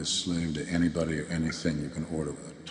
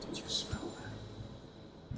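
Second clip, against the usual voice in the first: slow whisper; 10 dB quieter, much slower than usual. Acted. Sneering aside to camera.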